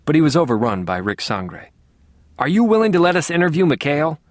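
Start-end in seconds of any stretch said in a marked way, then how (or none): 2.4-4.2 higher, louder, pushing demand. A narrator's reading. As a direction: press her hard for an answer. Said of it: "Sangre demanded".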